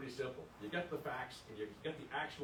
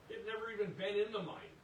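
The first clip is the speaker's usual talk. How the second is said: emotional; 8 semitones higher than usual. Real, not acted. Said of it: "Excuse me if I sound a little emotional here".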